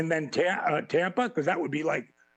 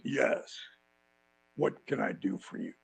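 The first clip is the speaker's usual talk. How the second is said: lower, quieter, cool and measured; a hint of a calm tough wrestler; understated low wrestler voice; lightly voiced Jake; 8 semitones lower, 4 dB quieter than usual. Voicing Jake the Snake calmly.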